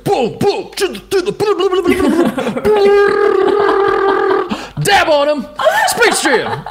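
high, loud, hyped-up trailer mimic. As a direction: rattle it off like a frantic trailer.

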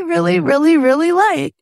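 really, really pushed high and loud; emphatic really. Real, not acted. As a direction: lean hard on each really. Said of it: "emphatic repetition".

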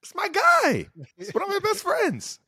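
very high falsetto protest; squeaky, exaggerated self-defense; mock indignation. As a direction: squeal it in playful disbelief. Protesting being called a bully.

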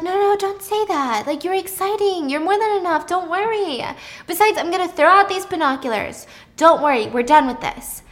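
high-pitched soothing; reassuring. Acted. Pippa telling Thomas he is enough, high and reassuring. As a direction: comfort an insecure partner.

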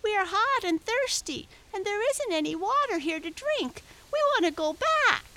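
very high, whiny lamb voice; crying lambs. A narrator's reading. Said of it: "cried the lambs".

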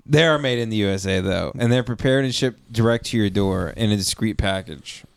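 upbeat sponsor read. Acted. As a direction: sell it like an ad.

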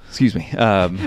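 talking through a yawn. Real, not acted. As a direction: let the yawn catch the words. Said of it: "Both of you guys yawning".

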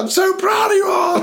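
high, excited praise; proud cheering. Retold or imitated. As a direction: gush over the team. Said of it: "I was just like".

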